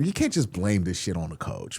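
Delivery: usual speaking voice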